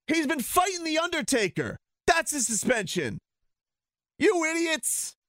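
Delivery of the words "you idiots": exasperated insult. Real, not acted. Ranting at journalists.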